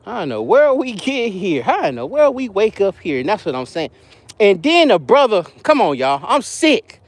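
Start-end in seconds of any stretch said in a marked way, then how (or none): none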